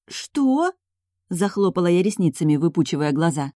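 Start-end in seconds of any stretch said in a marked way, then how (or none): 0.1-0.7 high, rising, incredulous question; astonished. A narrator's reading. Heroine reacting to the duke's unexpected compliment, astonished. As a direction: squeak it out in disbelief. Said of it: "вызвал моё неимоверное удивление".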